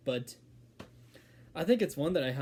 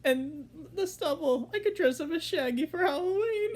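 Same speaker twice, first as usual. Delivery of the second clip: high goofy cartoon slacker; Shaggy; 3 dB louder than usual. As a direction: keep up the squeaky-high character voice, breaking into chuckles. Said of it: "that was my attempt at sh".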